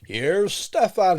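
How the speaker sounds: big stretched-out host introduction; podcast intro call. Acted. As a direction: slowly fire up the show with gusto. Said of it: "lose your accent".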